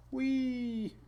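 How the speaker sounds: high squeak of delight; a tiny high "wee" at the little pup; cooing a high squeal; squeaky wee. Real, not acted. Delighted at the small puppy.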